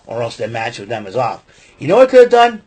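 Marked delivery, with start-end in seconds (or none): 1.8-2.6 excited. Real, not acted. Excited rhetorical question.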